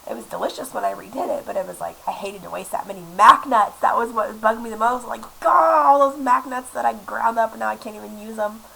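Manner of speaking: normal talking voice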